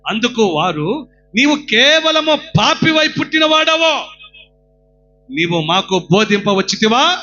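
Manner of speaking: loud, high preaching cry; fervent sermon shout; full-force preaching. Acted. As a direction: exhort the crowd with all your voice. Preacher reads verse loudly.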